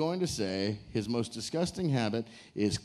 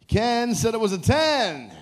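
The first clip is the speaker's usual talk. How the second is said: loud, high game show reveal; big, slow, shouted reveal; excited announcement; 7 semitones higher, 5 dB louder, slower than usual. Real, not acted. Host announces a matching answer.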